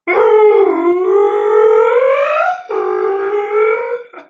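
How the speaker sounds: long, high, revving laugh; high crazy laugh. Shown as a demonstration. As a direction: squeal like a race car. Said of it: "When I do that voice, I'm like".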